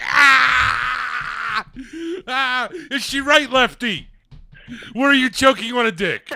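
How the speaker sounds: high, loud excited yelling; shrieking excitedly. Real, not acted. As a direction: squeal it out, laughing hard. Shrieking laughter at caller's joke.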